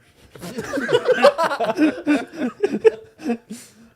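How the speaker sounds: big burst of laughter; loud, high laughing fit. Real, not acted. Laughing at scare joke.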